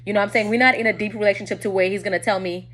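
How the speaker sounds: ordinary talk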